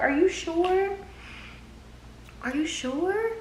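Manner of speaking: high, soft concerned imitation; friend's voice. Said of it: "And she was like".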